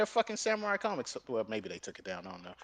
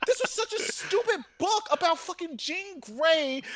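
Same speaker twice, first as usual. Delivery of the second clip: loud, high laughing outrage; loud, high, laughing disgust; laughing rant; 11 semitones higher, 7 dB louder than usual. Real, not acted. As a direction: shout it through laughter.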